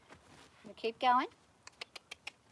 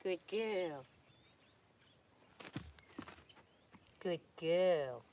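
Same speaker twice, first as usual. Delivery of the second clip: low, soft murmur of praise; calm the mare with a low voice; soothing praise; 7 semitones lower, 5 dB quieter, much slower than usual. Real, not acted. Soothing a nervous horse.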